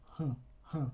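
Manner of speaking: tight smile nasal huh; smiling nasal sound demo. Shown as a demonstration. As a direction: stretch the lips, hum huh. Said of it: "stretch your lips horizontally into a tight smile".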